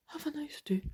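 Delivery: the same sign-off, breathed as a whisper; whispered goodbye. Retold or imitated. Repeating the stranger's farewell under her breath, whispered. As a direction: whisper it to yourself, bemused.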